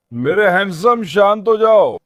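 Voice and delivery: calm him down from across the pen; calling to calm. Real, not acted. Calming a yelling dog.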